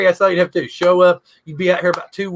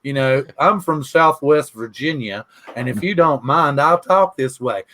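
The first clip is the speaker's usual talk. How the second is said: low-pitched Southern drawl; 4 semitones lower than usual. Shown as a demonstration. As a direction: lay on a Southwest Virginia drawl. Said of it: "for my Southern drawl to come out".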